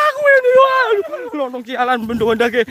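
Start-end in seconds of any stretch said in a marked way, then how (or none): 0.1-1.3 frightened high cry. Real, not acted. Pointing at ghost, frightened.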